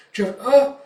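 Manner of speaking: high, loud broken-off exclamation; startled cry. Retold or imitated. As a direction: cry out and break off. Cry as girl is attacked.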